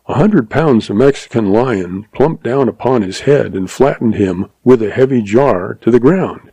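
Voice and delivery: ordinary delivery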